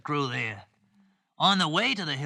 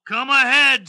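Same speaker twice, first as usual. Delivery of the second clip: loud, higher-pitched dare; big goat's challenge; 7 semitones higher, 10 dB louder than usual. Acted. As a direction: challenge the troll loudly as the biggest goat. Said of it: "said the big billy goat gruff".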